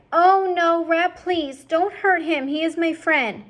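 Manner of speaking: high, loud begging; pleading cry. Acted. Princess begging Roscuro to spare Despero, high plea. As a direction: beg the rat. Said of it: "The princess cried".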